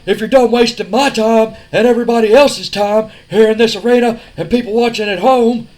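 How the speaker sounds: loud, high tough-guy promo voice; Austin promo shout. Retold or imitated. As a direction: bark it like a brash wrestler calling out the boss.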